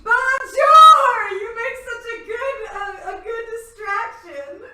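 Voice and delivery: very high, loud sing-song showboat; flamboyant boy. Acted. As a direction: belt it out theatrically, high and drawn-out. Voices the flamboyant boy arriving.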